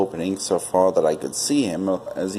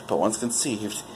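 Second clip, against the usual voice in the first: ordinary delivery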